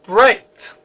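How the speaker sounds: mildly sarcastic remark; a touch of dry sarcasm. A narrator's reading. David after Gabriel vanishes into thin air, sarcastic. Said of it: "David says sarcastically".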